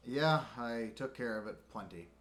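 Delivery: normal talking voice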